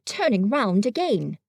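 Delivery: usual speaking voice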